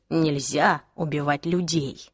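slow, low, measured echo; calm agreement. Acted. Jace echoing the girl's protest, calm and deliberate. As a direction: repeat it coolly, dropping the pitch.

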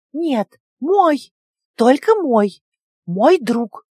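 high, loud possessive squeaks; jealous little creature. A narrator's reading. Pet creature refuses to share its friend, high jealous squeaks.

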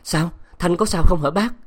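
put-on frantic worried young man; pressing questions. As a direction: grab him and demand an answer. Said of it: "chàng nắm áo hỏi dồn".

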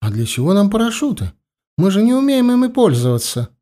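high-voiced eager foreign lady; lightly voiced pushy American woman; impatient American lady. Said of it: "не утерпела феминистка".

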